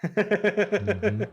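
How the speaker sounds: high, amused hum through a laugh; laughing mm-hmm. Real, not acted. Hearing the name click, laughing. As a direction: chuckle out the agreement.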